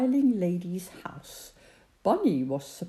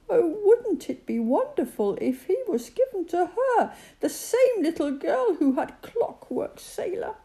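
hopeful rabbit; 10 semitones higher than usual. A narrator's reading. Rabbit hoping for Katy.

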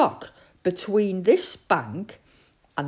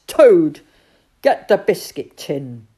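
loud command, hardest on the name; 11 dB louder than usual. A narrator's reading. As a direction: call Toad to the job. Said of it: "in a loud voice".

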